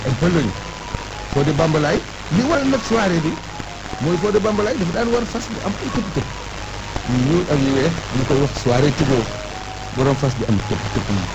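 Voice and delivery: usual speaking voice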